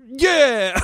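put-on high, loud Bronx-accent holler; shouted mock Bronx agreement; hammed-up Bronx yell. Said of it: "Is this a good Bronx accent?".

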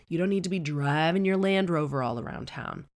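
ordinary talk

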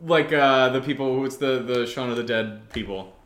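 ordinary talk, nothing marked

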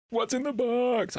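high, drawn-out movie-quote cry; hammed-up anguished film star impression; Brad Pitt. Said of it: "without going Brad Pitt".

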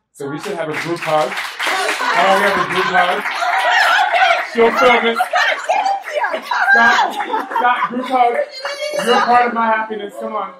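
happy shout for a group hug; calling the crowd into a group hug, excited. Real, not acted.

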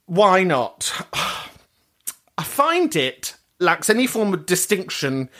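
normal talking voice

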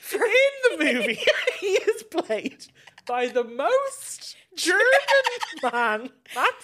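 high, loud, laughing through the words; helpless laughter. Real, not acted. Host telling co-host about the casting, cracking up. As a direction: struggle to get it out through real laughter.

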